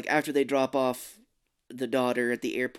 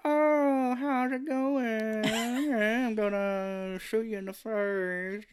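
high, very slow, drawn-out creepy voice; hammed-up slow creepy old man, half laughing; M. Emmett Walsh impression; 9 semitones higher, much slower than usual. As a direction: drawl it out like a menacing old man. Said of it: "theirs are like".

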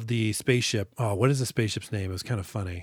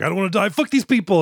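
high, louder, panicky outburst; mock panic; 7 semitones higher, 6 dB louder than usual. Host imitating the pilot dumping passengers, mock panic. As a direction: blurt it out in mimicked panic.

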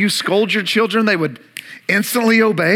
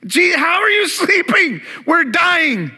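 panicked cry; 9 semitones higher, slower than usual. Retold or imitated. Disciples pleading with sleeping Jesus, panicked yell. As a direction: beg him to wake up, terrified. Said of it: "there's panic in their voices".

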